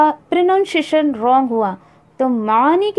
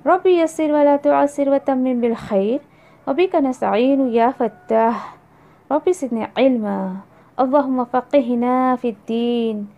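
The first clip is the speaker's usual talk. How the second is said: slow, earnest supplication; slow opening prayer; 3 semitones lower, slower than usual. Real, not acted. Opening prayer before lesson. (دعا، آہستہ اور عاجزی سے)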